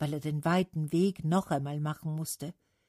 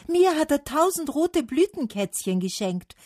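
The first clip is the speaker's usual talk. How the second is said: high, loud boast; calling out; 10 semitones higher, 6 dB louder than usual. A narrator's reading. Spruce praising Spring's gift, calling out. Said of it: "rief die Fichte".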